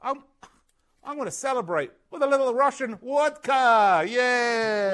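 high, loud celebratory toast; cheering with a drink in hand; gleeful toast. Real, not acted. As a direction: raise a glass and crow about it. Toasting the win with vodka.

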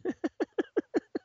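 high, breathy chuckle; amused laugh. Real, not acted. Laugh after teasing remark.

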